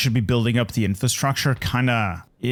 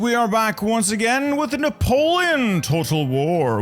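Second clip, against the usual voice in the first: grand announcer; 8 semitones higher than usual. Acted.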